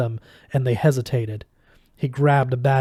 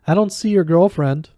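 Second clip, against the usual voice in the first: sneering, raised and louder English schoolboy taunt; nasty taunt; 5 semitones higher, 5 dB louder than usual. A narrator's reading. Martin taunting Quentin about his absent girlfriend, nastily. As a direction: lean into the nastiness, high and loud. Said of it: "Martin said na-, nastily".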